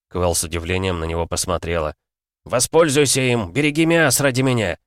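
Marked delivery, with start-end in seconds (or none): 2.4-4.8 higher, raised-voice entreaty; impassioned command; urgent plea. A narrator's reading. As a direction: charge a friend with a last duty. Urgent farewell plea in the storm.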